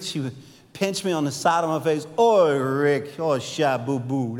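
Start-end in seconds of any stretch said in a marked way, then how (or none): 2.2-4.4 Cajun mama. Retold or imitated. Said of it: "real thick Cajun accent".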